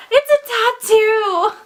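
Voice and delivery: high, loud delighted surprise; thrilled gasp of discovery; excited discovery. Real, not acted. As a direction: squeal it out as you find the tattoo. Surprised to find a tattoo.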